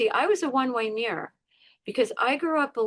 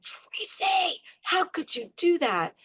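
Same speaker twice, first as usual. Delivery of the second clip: scolding inner voice; 8 semitones higher, slower than usual. Retold or imitated. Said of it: "my head's screaming at me".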